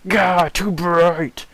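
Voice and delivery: high, loud, very slow screech; a creature recoiling from the light; hammed-up monster shriek; shrieking monster girl. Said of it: "she's like".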